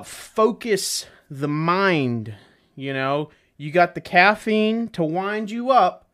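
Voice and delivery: normal talking voice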